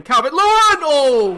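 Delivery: high, very loud shout of the name; goal scream. Real, not acted. Gamer yelling as his striker scores again, ecstatic shout.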